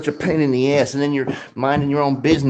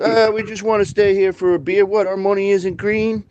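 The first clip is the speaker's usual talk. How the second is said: higher-pitched mock biker protest; imitated biker; 6 semitones higher than usual. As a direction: act out a biker arguing for service. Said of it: "He's like".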